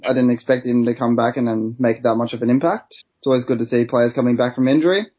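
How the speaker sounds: ordinary talk, nothing marked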